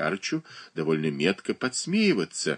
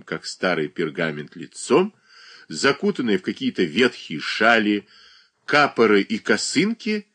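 ordinary delivery, nothing marked

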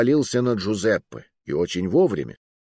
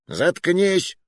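higher-pitched irritated retort; grumbled rebuke; 9 semitones higher than usual. A narrator's reading. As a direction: shut him up, mildly peeved. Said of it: "буркнул толстяк". (раздражённо буркнуть)

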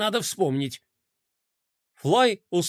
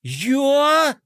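startled; 8 semitones higher, 4 dB louder than usual. A narrator's reading. Shoved off the mast.

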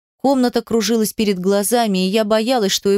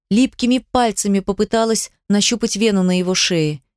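usual speaking voice

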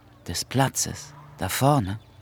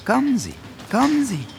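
eager urging; 10 semitones higher, faster than usual. Acted. Mandungu urging the guest along.

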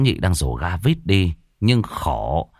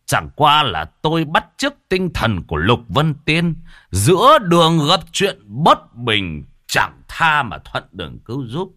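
put-on boastful old man; 6 semitones higher than usual. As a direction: puff himself up like a hero. Lão Nhị boasting in character. (giọng lão già khoe khoang)

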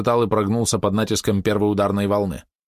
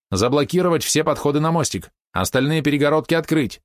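higher-pitched string of orders; commanding; 8 semitones higher than usual. A narrator's reading. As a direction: give orders firmly, voice lifted. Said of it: "она продолжала командовать". (командным тоном)

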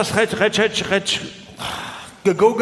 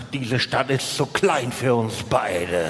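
lower, slow cowboy challenge over a dust bunny; western showdown line; 6 semitones lower, 3 dB quieter, slower than usual. Retold or imitated. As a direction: lower and slow, a mock gunslinger drawl. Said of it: "Ich sag".

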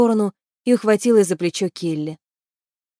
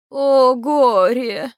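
long drawn-out, higher keening cry; wailing lament; 4 semitones higher, 3 dB louder, much slower than usual. A narrator's reading. Banshee keener foretelling woe by the stream, wailing. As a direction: wail it slowly, stretching every vowel. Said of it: "выла плакальщица".

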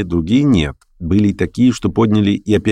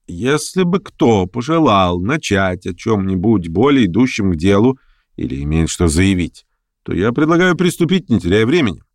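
ordinary delivery

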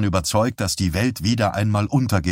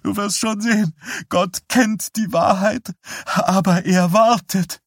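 tearful insistence; 12 semitones higher than usual. A narrator's reading. Defeated wife insisting God knows the truth, tearful. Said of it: "sagte sie unter Tränen".

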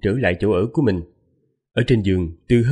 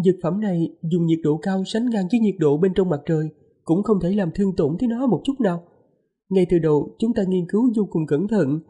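higher, lightly voiced young wife; man doing a woman's voice; 7 semitones higher than usual. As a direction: speak up high as the gentle wife. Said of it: "Lát giả giọng nữ".

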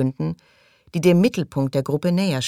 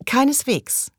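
much higher, firm no; contradicting; 9 semitones higher, slower than usual. A narrator's reading. Fraser contradicting Thug, higher and firm. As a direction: cut him off with a flat denial. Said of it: "widersprach Fraser".

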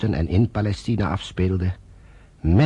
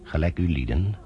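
solemn scripture reading. Acted. Narrator opening the play with Scripture, solemn reading. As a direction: intone it like a reading from the pulpit.

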